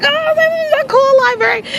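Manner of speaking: excited wonder; awed delight. Retold or imitated. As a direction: gush over the library. Awed in dream library.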